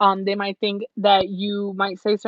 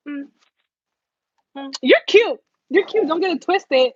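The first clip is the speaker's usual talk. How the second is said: higher, louder, teasing "you're cute"; mock stuck-up flirt; 5 semitones higher, 5 dB louder than usual. Stuck-up person teasingly compliments someone, higher and louder. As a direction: purr a haughty compliment. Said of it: "someone who's just like".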